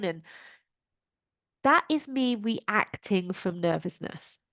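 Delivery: normal talking voice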